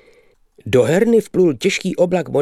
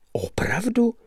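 astonished whisper; 11 semitones higher, 5 dB quieter than usual. Acted. Narrator astonished at bridge rules.

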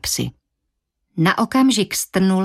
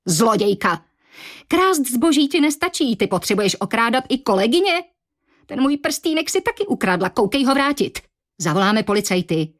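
higher, accusing outrage; indignant voices; 6 semitones higher than usual. Acted. Coworkers accuse Anna of theft, indignant higher voices. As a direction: lash out at the thief. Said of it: "rozhořčené hlasy".

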